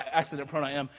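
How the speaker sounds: usual speaking voice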